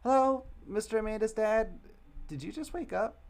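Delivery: put-on high, loud child voice; little girl's voice. Girl teasing Amanda's dad about his pants, high-pitched. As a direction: squeak it like a nosy little girl. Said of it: "I don't have voices for any of these people".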